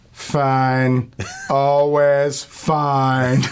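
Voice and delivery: drawn-out, seething mock waitress; hateful waitress. Overloaded waitress answering "how you doing", hateful. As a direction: stretch each word out with loaded resentment. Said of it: "overloaded and full of hate".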